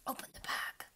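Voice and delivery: softly whispered order; voiceless whisper. Acted. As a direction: whisper it urgently. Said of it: "he whispered".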